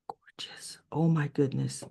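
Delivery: whispered awe; hushed admiration. Real, not acted. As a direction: gasp it softly under your breath. Admiring a picture of Anita.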